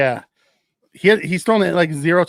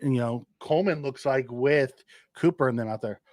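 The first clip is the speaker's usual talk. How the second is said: normal talking voice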